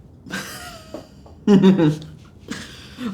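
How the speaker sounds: a loud, high scoffing laugh; scornful laugh. Real, not acted. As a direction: laugh it off with contempt. Laughs at the write-up.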